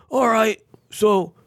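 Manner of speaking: imitating a gruff veteran, pitched well up; mock him as a sneering old vet on the radio; sarcastic angry veteran. Radio caller needling the host, mimicked high and sarcastic. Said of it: "It was an angry Vietnam, uh, era, uh, veteran, angry".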